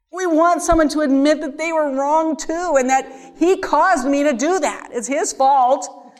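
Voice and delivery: high, loud acted accusation; mock blaming. Speaker voicing our wish to blame him, mock indignant.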